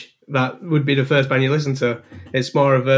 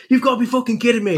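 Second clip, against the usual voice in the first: higher, fast incredulous outburst; mock disbelief; 7 semitones higher, faster than usual. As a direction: rattle it off in horrified disbelief. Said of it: "And I was like".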